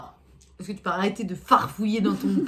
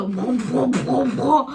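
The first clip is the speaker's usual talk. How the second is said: engine noise; 2 semitones higher, 6 dB louder, much slower than usual. Pretend.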